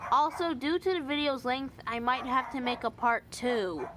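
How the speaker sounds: ordinary talk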